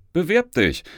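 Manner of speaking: called out. A narrator's reading. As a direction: shout encouragement while leaving. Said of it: "rief sie mir zu".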